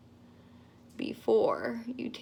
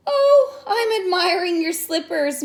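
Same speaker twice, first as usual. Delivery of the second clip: muttered; 7 semitones higher, 6 dB louder than usual. Acted. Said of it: "muttered Caramba".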